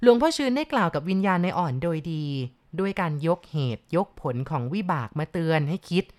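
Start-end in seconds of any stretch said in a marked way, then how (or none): none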